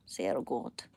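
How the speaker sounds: deep goofy Sehr gut; deep put-on voice. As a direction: drop into a comic deep voice. Repeats German phrase in two voices.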